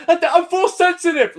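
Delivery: high, loud, strained reveal; mock dramatic confession. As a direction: blurt it out like a dying hero. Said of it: "Why is he gonna go".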